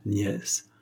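soft smiling repeat. A narrator's reading. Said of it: "dit en souriant Dussonville".

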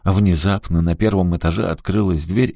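usual speaking voice

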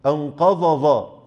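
أنقض ظا slowed, the ض blurred into ظ; slow demo of the merging error; both as zaa. Shown as a demonstration. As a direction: drag out أنقض ظا with no difference between the letters. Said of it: "هما الاتنين زي بعض".

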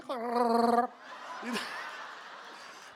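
long rolled-R Wookiee growl; Chewie. Acted. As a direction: trill the R into a drawn-out roar. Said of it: "He did Chewie".

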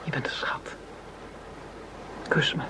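breathy whisper, close and soft; intimate whisper. Acted. Simone seduces Henri before the mission, whispered. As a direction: whisper it to him as a lure. Seducing her right-hand man.

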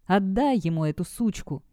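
usual speaking voice

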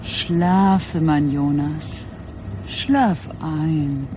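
slow, low and drawn out; hypnotic lulling. Acted. Woman coaxes drugged detective to sleep, slow and soothing. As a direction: lull him gently under, stretching each word.

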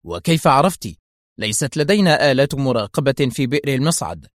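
ordinary delivery, nothing marked

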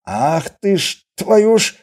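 slow start rising high and loud; furious, squeezed out. Acted. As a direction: force out the curse in rage. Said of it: "только и смог выцедить разъярённый Костоправ". (разъярённо выцеживая слова)

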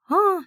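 a high, thin startled squeal. A narrator's reading. Maid frightened by a sudden landing, high thin squeal. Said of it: "тоненько завизжала служанка".

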